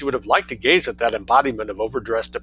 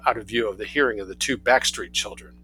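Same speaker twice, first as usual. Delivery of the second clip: plain reading voice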